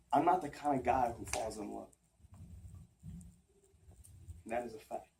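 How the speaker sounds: low, soft and halting; hushed, heartfelt stage delivery; subdued confession. Acted. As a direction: confess love reluctantly, with long pauses. Monologue turns to reluctant love confession.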